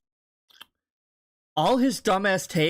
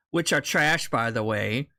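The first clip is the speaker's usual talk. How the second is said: ordinary talk, nothing marked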